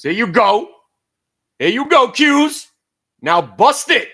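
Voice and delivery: loud high cheering at the chart; cheering the stock. Real, not acted. Trader cheering on his stock as it rises. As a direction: urge the Qs on, yelling.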